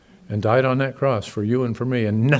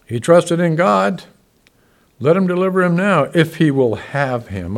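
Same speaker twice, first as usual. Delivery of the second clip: mocking taunt; 6 semitones higher, 8 dB louder than usual. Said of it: "Are you kidding me".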